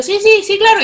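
high, loud rapid yeses; eager fake agreement. Nodding along to the Manchester classmate, eager fake agreement. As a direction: nod along, pretending you follow. Yo aceptaba todo lo que le decía.

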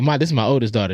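normal talking voice

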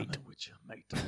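whispery, hushed mock Cockney; Cockney accent. Echoing the co-host's impression, whispered Cockney accent. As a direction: echo it softly in a rough-edged Cockney. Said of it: "Og bare Cockney accent".

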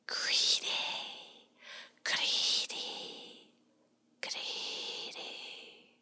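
fading, spooky whisper from the dark; eerie whisper. A narrator's reading. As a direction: hiss it like a hidden swamp spirit. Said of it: "a whispery voice from the swamp".

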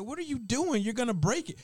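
high mock-alarmed warning; worried chef. Chef warning the cook about the blender, mock alarm. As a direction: sound like a chef sure the cook will wreck it.

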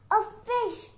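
high eager gasp of hope; bright hopeful exclamation; a fisherman spotting a catch. Acted. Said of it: "he thought eagerly".

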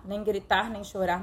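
ordinary talk, nothing marked

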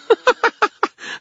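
very high, loud fairy giggle; tinkling high-pitched burst of laughter; sparkling fairy laugh. A narrator's reading. Fairy laughs at the wizard's question, sparkling high laugh. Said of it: "Toot let out a sparkling laugh".

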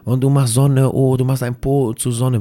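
lauter, höher, spottend nachgeäfft; spöttischer Kollege. Retold or imitated. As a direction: den höhnischen Kollegen nachmachen. Kollege verspottet sein Gebet. (Kollege macht sich über das Gebet lustig, laut spottend)

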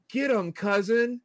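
high, twangy Southern hillbilly drawl; Southern hillbilly accent. Retold or imitated. Mocking a movie character's sudden hillbilly accent. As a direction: sneer it out like a backwoods cousin. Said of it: "where did that accent come from".

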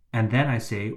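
ordinary talk, nothing marked

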